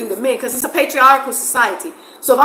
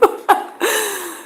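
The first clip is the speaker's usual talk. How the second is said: high laugh; 11 semitones higher than usual. Real, not acted. Amused at the contrast.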